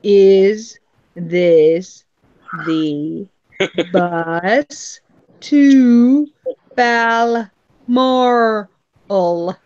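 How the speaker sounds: every word drawn out, very slow with long gaps; slow, exaggerated question to a baffled girl at the bus stop; painfully slow. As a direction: spell it out slowly for someone who can't follow. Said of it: "my last sentence to her was".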